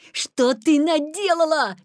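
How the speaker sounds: loud, high, drawn-out cry; alarmed shout. A narrator's reading. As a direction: cry out in reproach. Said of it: "крикнул Петя".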